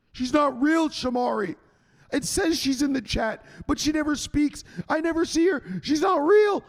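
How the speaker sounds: high-pitched insistent denial; mock outrage, rising to a high shout; mock protesting. Acts out argument over whether she exists.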